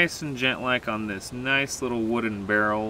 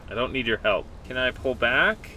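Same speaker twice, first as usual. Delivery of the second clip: usual speaking voice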